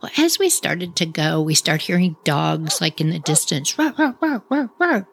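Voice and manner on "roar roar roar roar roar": high yappy mock barks, a bit above her voice; imitated dog barking. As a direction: bark like distant dogs, playfully.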